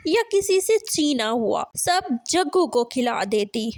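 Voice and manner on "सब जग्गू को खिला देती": ordinary delivery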